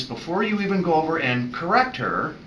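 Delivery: usual speaking voice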